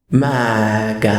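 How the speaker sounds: low, loud, eerie creature cry; low ghastly shout from beyond the door. Acted. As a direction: rasp it out like a ghost girl. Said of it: "in a ghastly voice spoke out".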